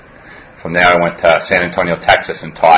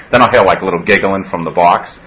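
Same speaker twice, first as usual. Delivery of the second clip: ordinary delivery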